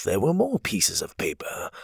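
plain reading voice

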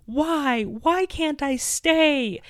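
high pleading wail of a daughter; a mom voicing her sobbing daughter's cry; imitating screaming child. Mom retelling her daughter's screaming protest, high and pleading. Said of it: "She started screaming at me and sobbing hysterically".

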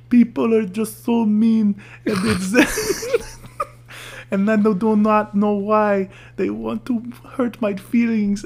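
very high, whiny French-girl imitation; mock crying French girl. Host imitating a French girl crying on video, mock weepy. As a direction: wail it in a hammed-up French accent. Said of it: "she was, like, a French girl".

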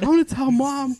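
high, loud tattletale threat; sing-song I'm telling Mom, high and loud; tattling sister. Retold or imitated. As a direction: threaten to tell like a bratty sibling.

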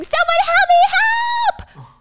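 very high, loud scream; screaming for help. Retold or imitated. Gia pinned in the alley, screaming for help. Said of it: "Gia just starts screaming".

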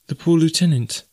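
high, concerned questioning; anxious young woman. A narrator's reading. Jane anxiously asks after D'Arnot.

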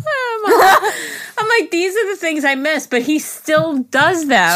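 high, tearful, laughing voice; weepy laugh easing as it goes; laughing and crying. Real, not acted. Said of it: "guys, I'm crying".

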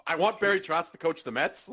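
ordinary talk

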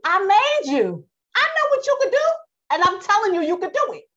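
high, loud, insistent God; Yah speaking. Acted. Yah overruling doubting Jeremiah, in a high loud proclamation. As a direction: cry it out as a forceful divine command. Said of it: "Yah said".

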